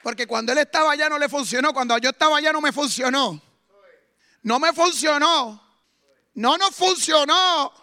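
loud, high and slowing, hammering the line; shouted preaching. Acted. Preacher shouting his testimony to the church, loud. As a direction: proclaim it with everything.

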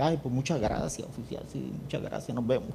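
soft, trailing-off thanks; meek thanks. Retold or imitated. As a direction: thank him sheepishly, fading away. Pastor thanks officer meekly.

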